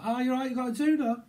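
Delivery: high, loud Aussie caller; mock Australian shout-out; Aussie accent. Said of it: "someone could be from, uh, Aussie".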